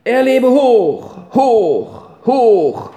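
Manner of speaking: loud, high, slow hurrah; group cheer. Acted. All the children cheer the boy, loud and high. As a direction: shout it out like a crowd. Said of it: "Und alle riefen".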